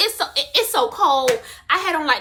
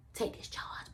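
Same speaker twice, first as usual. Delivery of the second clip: whisper; 19 dB quieter, slower than usual. Pretend. Imagined whisper to other friend.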